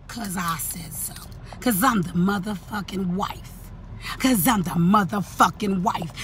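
slow, firm finality. Real, not acted. Wife answering the rival's why, slow firm finality. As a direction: drop low and slow, laying down the law.

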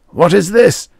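high, loud, frightened quaver. A narrator's reading. A producer threatened with an ashtray, quavering. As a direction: voice shaking at the threat. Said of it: "the producer's voice quavered".

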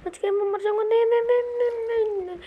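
very high, frantic cries of no; panicked wail. Pretend. Gamer fears he will die, high panicked wailing.

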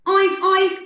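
two high, short oinks; pig oink. Shown as a demonstration. Telling Janie what the pig says, high oinking. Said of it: "The pig says".

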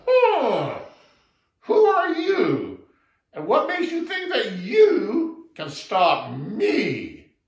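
loud, slow mocking giant; scoffing elephant. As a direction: sneer down at the little frog. Elephant scoffs at small frog.

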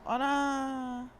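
high, loud, long-held exclamation; shocked cry. Real, not acted. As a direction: wail out in surprise at the game. Shock at game event.

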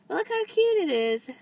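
excited high squeal of cute; gushing. Real, not acted. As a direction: show it off with glee. Showing off a charm.

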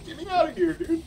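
high, loud squeal of urgency; urgent yelp. Real, not acted. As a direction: yell it out high, desperate to escape. Panicked escape during live game.

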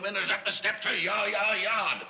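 blustering, fed-up outburst; exasperated bellow. Acted. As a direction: bellow over the whole squabbling family. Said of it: "Will you stop bellowing?".

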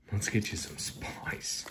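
slow secretive whisper over the pack; hushed slow whisper. Pretend. Hushed line while opening pack.

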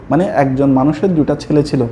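ordinary delivery

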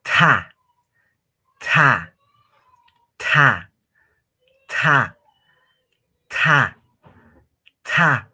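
loud TA with a strong puff of air after the T; aspirated T. Shown as a demonstration. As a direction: blow through the T into A. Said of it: "Tipo soplando".

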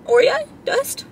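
high squeaky surprised question. Real, not acted. Spotting Oreo dust on her cake pop, surprised.

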